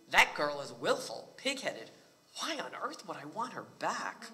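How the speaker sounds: exasperated father, pitch swooping up and down; lightly acted indignant refusal; dismissive complaint. A narrator's reading. Bride's father refuses to take his daughter back, exasperated.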